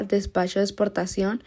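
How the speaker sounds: ordinary talk, nothing marked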